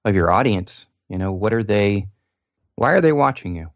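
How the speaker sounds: ordinary talk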